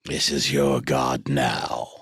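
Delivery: deep ominous voice. Pretend. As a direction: intone it like a dark idol. Joking cult-leader line.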